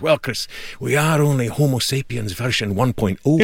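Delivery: put-on friend's Scottish accent, said with a laugh. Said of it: "in this Scottish accent and he goes".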